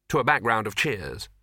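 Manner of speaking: usual speaking voice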